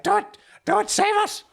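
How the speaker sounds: very high, loud frantic shout; panicked cry. Acted. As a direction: scream for rescue. Panicked innkeeper cries out.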